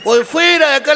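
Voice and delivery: mock bouncer shout.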